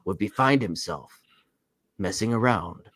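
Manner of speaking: low, soft movie-trailer narrator; deep narrator voice. Retold or imitated. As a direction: intone it like an epic documentary narrator. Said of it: "It's like that deep narrator voice".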